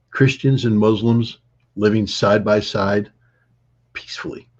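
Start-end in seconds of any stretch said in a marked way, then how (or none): 3.9-4.5 peacefully said as a soft, breathy whisper; emphasis on peacefully. Shown as a demonstration. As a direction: drop to a whisper on peacefully for weight. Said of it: "I emphasize the word peacefully".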